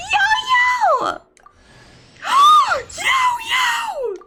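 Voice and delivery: real gasping shrieks over a lucky pull; ecstatic shrieks. Real, not acted. Gacha pull result reaction.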